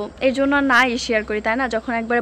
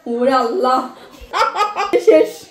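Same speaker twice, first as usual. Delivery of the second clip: giggly teasing voice, pitched up; 8 semitones higher, 6 dB louder than usual. Real, not acted. Teasing baby Aksa, laughing.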